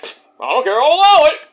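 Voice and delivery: a crooked ref waving it through; mock referee allowing it. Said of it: "Eli's like".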